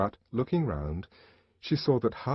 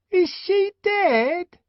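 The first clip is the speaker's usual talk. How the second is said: high, louder, slow pestering question; the twin pestering the savage again, louder; insistent twin; 8 dB louder, much slower than usual. A narrator's reading. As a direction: press him again, louder. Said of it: "repeated the inquisitive twin".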